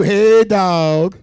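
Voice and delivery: lightly voiced smooth talker; mocking worldly flatterer. Preacher mimicking the world flattering the rich man, low and smooth. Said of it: "the world told you".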